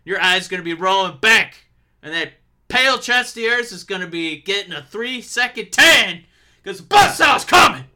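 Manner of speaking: building to a yelled climax; wrestling promo. Pretend. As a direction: shout it to the crowd. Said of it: "cut a promo".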